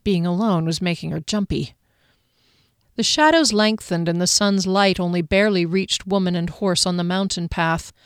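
usual speaking voice

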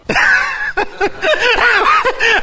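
loud high laugh of delight; joyful burst of laughter. Real, not acted. Preacher laughing with joy at his own point.

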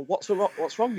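high, worried double ask of a scared lad; startled kid pleading with his dad; mildly panicked young rider; horrified question. Retold or imitated. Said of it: "I'd have horror on my face".

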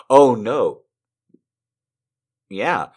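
ordinary talk, nothing marked

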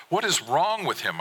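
high-pitched, exasperated demand. A narrator's reading. Father starts in on mother about son, exasperated. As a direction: pitch the question up in frustration.

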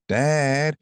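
high, slow mocking sister voice; tattling sisters. Little sister telling on him to dad.

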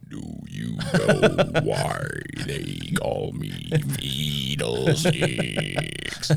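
very deep, slow, menacing alien voice. Acted. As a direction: drag each word out in an otherworldly growl. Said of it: "in an utterly alien voice".

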